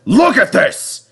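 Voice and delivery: scold, pointing at the mess; angry scolding. Acted. Controller scolding Percy.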